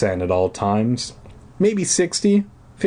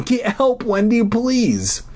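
high, slow pleading; desperate plea; 12 semitones higher, slower than usual. Acted. Deirdre begging Wendy to run for help. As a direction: beg her to get help. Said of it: "Deirdre pleaded".

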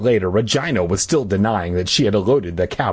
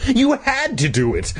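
mock scream; 12 semitones higher than usual. A narrator's reading. Boy accusing his sister, mock screaming. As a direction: squeal the accusation without full volume. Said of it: "Todd screamed".

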